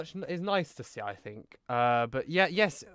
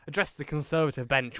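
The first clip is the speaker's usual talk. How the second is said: ordinary talk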